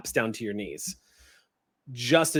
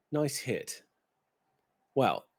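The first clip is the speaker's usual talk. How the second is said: wry fallen angel; 5 dB quieter, slower than usual. Acted. GM voices Cadmiel greeting Jones.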